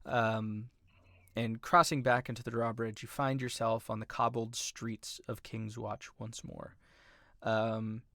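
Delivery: ordinary talk, nothing marked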